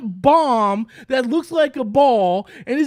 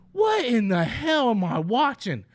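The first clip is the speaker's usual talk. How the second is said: normal talking voice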